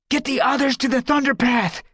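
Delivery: high, loud urgent command; urgent order. Acted. Brambleclaw ordering One Whisker away, urgent shout. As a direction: bark the order to save them.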